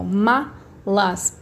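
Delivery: malas very slow, MA held apart from LAS; stressed syllable shown. Shown as a demonstration. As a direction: stretch malas out, MA as the strong beat. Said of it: "a minha sílaba mais forte".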